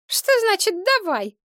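put-on high-pitched indignant girlfriend; snappish. A narrator's reading. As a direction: snap back in a shrewish squeal. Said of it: "Девица окрысилась". (огрызается высоким голосом)

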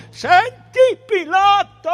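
very high, loud shout; defiant cry. A narrator's reading. Caifa challenges Pilate, shouting high. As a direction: cry out at full pitch.

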